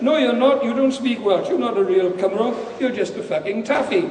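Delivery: high, loud opening that eases as it goes; scornful accusation. Acted. Blackbeard to Dave about not speaking Welsh, scornful. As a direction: throw it at him as an insult.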